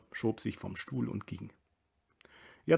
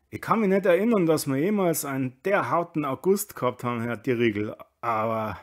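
grumbling local burgher; 5 semitones higher, 8 dB louder than usual. A narrator's reading. Kammerlander grumbles to Dierriegel about August, aggrieved. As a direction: complain emphatically to an acquaintance.

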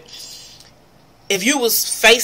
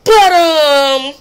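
higher, louder mocking imitation of a girl stalling with "but, um"; imitating her "but, um"; 8 semitones higher, 7 dB louder than usual. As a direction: mimic her hesitant filler, pitched up. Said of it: "like she had to take a swallow".

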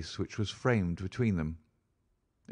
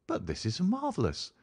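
high-pitched wonder without extra volume; amazed; 10 semitones higher than usual. Acted. Friend struck by the portrait's likeness, amazed. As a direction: marvel at it, pitch lifted.

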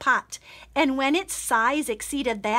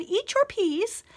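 queen voice; 8 semitones higher than usual. Acted. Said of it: "the queen said".

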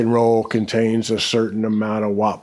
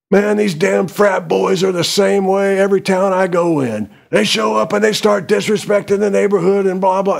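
higher-pitched, put-on voice of a ranting rock star; 9 semitones higher than usual. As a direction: grumble about frat boys like a road-worn rocker. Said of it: "he's like".

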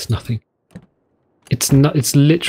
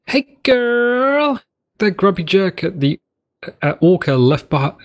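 put-on girly voice; 6 semitones higher than usual. Reading a note in character voice.

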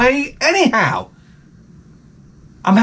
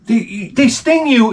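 ordinary talk

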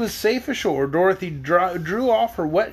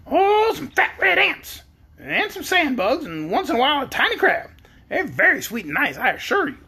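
yellow hen; 8 semitones higher than usual. A narrator's reading. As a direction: relish the menu as a talking hen. Storyteller voicing the yellow hen.

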